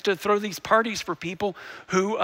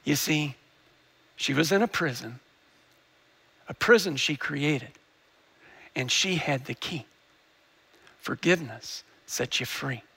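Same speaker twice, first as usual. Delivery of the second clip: hushed sermon point; 6 semitones lower, 4 dB quieter than usual. Acted. Preacher to congregation, hushed and lower. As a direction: let the lesson land gently.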